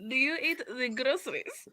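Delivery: mild Russian villain voice; hint of a Russian baddie; Russian villain accent. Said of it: "You sound like some Russian villain".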